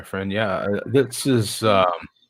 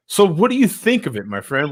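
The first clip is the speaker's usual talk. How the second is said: usual speaking voice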